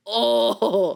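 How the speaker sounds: long, loud villainous oh; evil person. Acted. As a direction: gloat like a cartoon villain. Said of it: "All evil people make that voice".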